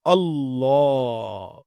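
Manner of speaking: اللہ drawn out very slowly with a hard doubled lam; اللہ held long and heavy on the shaddah. Shown as a demonstration. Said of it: "پڑھنے میں سختی ادا کرنی پڑتی ہے۔".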